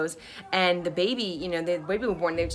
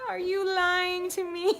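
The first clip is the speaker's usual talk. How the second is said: laughing disbelief; 11 semitones higher, slower than usual. Retold or imitated. Exhausted mother doubting midwife.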